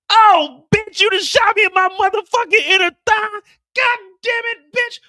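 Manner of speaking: very high, loud yelling in pain; the hitman shot in the thigh; pained screaming. Acted. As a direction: howl like you just got shot.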